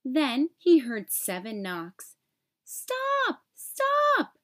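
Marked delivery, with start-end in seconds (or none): 2.6-4.4 high, sharp cry of stop. A narrator's reading. Even Steven crying out at the knocking at his door. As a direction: call out stop twice, high and urgent. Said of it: "Even Steven cried out twice".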